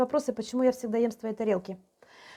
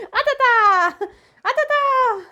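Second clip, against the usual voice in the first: high, loud playful dog talk with a laugh; squeaky praising a dog; 12 semitones higher, 8 dB louder, slower than usual. Real, not acted.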